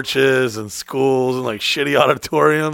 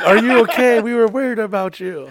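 worried Uber driver; 7 semitones higher than usual. Retold or imitated. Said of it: "They're like".

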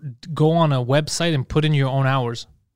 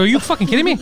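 high, pitched-up mock rant; high-pitched mock outrage at a tester; retold exasperation; 10 semitones higher, faster than usual. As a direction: act out losing it at him. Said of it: "I go".

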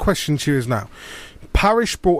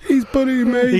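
whiny footballer; 9 semitones higher than usual. Retold or imitated. Mocking footballers' complaint.

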